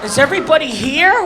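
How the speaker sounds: normal talking voice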